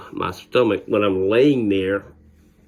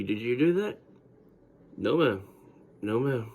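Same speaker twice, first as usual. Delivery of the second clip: much softer hushed exchange; quiet; 8 dB quieter than usual. Retold or imitated.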